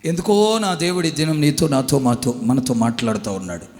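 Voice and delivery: loud, descending declaration; solemn pulpit emphasis; forceful preaching. Acted. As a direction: press it on them.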